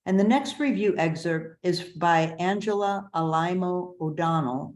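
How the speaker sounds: ordinary delivery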